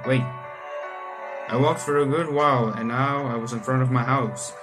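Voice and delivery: normal talking voice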